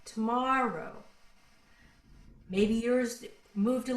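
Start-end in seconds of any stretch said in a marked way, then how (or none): 0.0-0.9 "tomorrow" stretched with a falling end; shown inflection. Shown as a demonstration. Teacher showing how her tomorrow went, drawn-out falling inflection. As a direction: draw out "tomorrow" and let it drop. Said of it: "So my tomorrow went".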